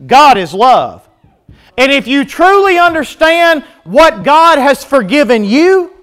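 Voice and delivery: fervent preaching. Real, not acted. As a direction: preach it with rising force.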